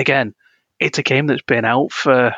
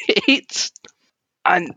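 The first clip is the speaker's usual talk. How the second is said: high, laugh-filled word; squeezed out high through laughter. Real, not acted. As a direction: break into real laughter while starting to speak. Laughing at the game's classes.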